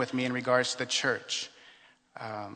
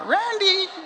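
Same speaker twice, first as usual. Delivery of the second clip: high, loud call across the parking lot; 9 dB louder than usual. Retold or imitated. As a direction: call his name from far off to catch him. Nun calls after him at car.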